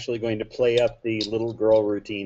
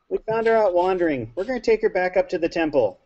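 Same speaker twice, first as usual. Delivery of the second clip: in-character explanation; 8 semitones higher than usual. Acted. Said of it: "I'm just gonna say".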